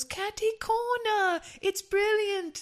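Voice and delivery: high, slow, loud delighted British exclaim; squealing with delight at a new phrase; excited Brit. Retold or imitated. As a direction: gush over it like a thrilled Englishman. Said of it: "He goes".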